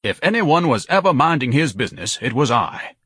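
lightly voiced pompous indignation; indignant son. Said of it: "Ignatius breathed".

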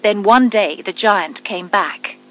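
usual speaking voice

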